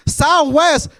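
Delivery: high, loud imitation of mom ordering; mock struggling mom.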